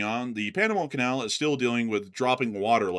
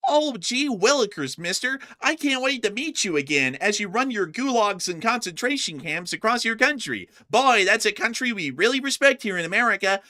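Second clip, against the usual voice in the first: put-on wholesome 1950s kid; 8 semitones higher than usual. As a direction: gush like a naive little boy. Mocking president's friendly diplomacy.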